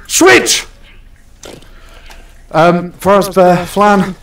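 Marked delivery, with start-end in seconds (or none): 0.1-0.7 shout. Real, not acted. Shouting to swap stations.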